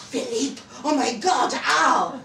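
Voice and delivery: loud, high shocked exclamation; shocked cry. Acted. Delphine cries out on hearing Philippe knows, loudly shocked. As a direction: gasp out the words in alarm.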